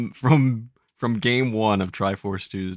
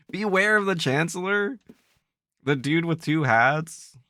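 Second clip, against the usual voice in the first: high, incredulous rising question; can't believe what you just heard; baffled question; 7 semitones higher than usual. Acted. Reacting in character, baffled.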